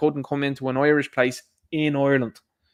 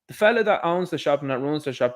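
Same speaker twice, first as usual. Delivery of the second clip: ordinary talk, nothing marked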